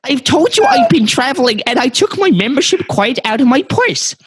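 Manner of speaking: put-on high, loud New Jersey accent sliding into Swedish; shrieky mock New Jersey accent gone Scandinavian; botched New Jersey accent. Customer insisting on her membership in a botched New Jersey accent. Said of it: "gonna be from New Jersey".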